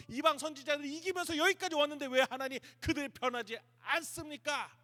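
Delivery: high, loud, indignant remonstrance; confronting, arguing. Acted. Elijah argues before God about Israel, indignant challenge. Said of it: "하나님 앞에 따지는 거예요".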